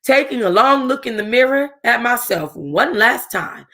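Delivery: ordinary delivery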